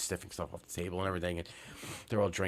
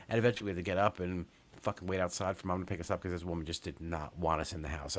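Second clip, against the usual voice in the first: usual speaking voice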